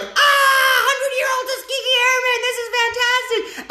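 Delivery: high, hammed-up gushing squeal; mock ecstatic crowd. Vlogger imitating everyone's gushing at the speech, high squeal. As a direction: gush like an overexcited crowd. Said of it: "everyone's like".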